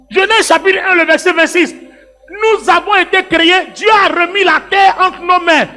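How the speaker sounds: high, fervent preaching voice; full-force preaching. Real, not acted. Pastor exhorts congregation to claim the earth, fervent preaching. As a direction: preach it to the crowd.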